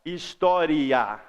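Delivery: "história" very slowly, the I gliding into the final A; slow, drawn-out "história"; semivowel demo. Shown as a demonstration. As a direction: stretch out "história" so the "ia" shows. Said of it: "Olha como que esse I é semivogal".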